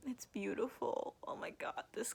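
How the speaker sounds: hushed, as if afraid to break the spell; awed whisper. Real, not acted. Admiring the book cover.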